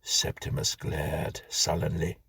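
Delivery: plain reading voice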